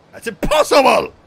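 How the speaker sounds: high, very loud cry of disbelief; alarmed shout. Acted. Voicing alarmed game character.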